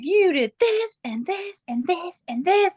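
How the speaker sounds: high-pitched pile-up of accusations; mock spewing outburst. Partner unloading stored-up resentment on the other, mock rant. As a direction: spew it out high, hammering each item. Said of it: "like spewing lava coming out".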